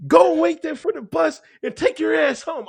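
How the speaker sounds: high, loud, slow barking tirade; drill sergeant yelling. Retold or imitated. Drill instructor yelling at a confused recruit, loud high bark. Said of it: "The motherfucker said".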